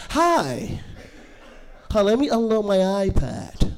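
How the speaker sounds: put-on ten-year-old climbs out of carriage, cheery kid voice; little kid. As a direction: play a spoiled little kid hopping out.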